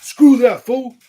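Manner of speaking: high, loud put-on voice egging himself on; pumping himself up. To himself at the lake, high loud mock hype voice. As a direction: shout it like a cocky friend daring you. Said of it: "I punked myself and was like".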